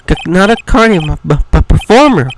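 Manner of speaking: flustered correction, stuck on first sounds; jittery, halting self-correction; nervous stammerer. Acted. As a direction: stutter on the consonants, then push the word out. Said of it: "This guy is so nervous".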